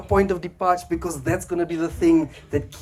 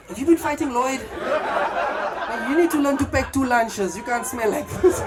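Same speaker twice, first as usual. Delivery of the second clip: higher, laughing teasing; 9 semitones higher than usual. Retold or imitated. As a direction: tease a friend who reeks. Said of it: "They're like".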